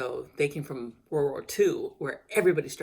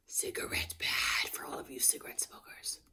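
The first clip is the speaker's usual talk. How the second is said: whispering; 6 dB quieter than usual. Acted. Mock warning whispered aside.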